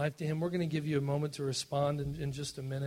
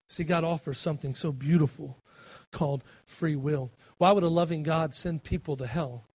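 usual speaking voice

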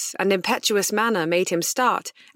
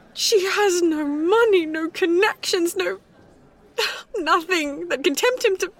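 distraught; 7 semitones higher than usual. A narrator's reading. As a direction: pour out the disaster in despair. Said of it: "Elizabeth went on".

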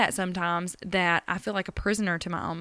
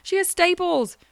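tearful worry; 8 semitones higher than usual. Retold or imitated. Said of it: "I'm like".

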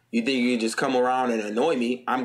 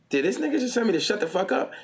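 mock-offended baby, pitched high; high, incredulous retort in a baby's place; taken aback; 8 semitones higher than usual. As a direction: act out a stunned, indignant question. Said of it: "he's gonna be kinda taken aback".

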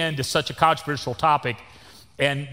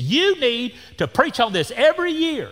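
finger-wagging lady; 8 semitones higher than usual. Retold or imitated. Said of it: "she's pointing that finger at me".

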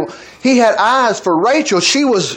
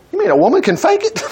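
higher and fast, laughing through it; laughing disbelief; 10 semitones higher, faster than usual. Real, not acted. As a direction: laugh as you ask it. Laughing at scheming wife.

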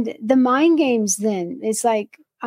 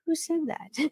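imitated therapist; 5 semitones higher, 9 dB quieter than usual. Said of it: "And she texts back".

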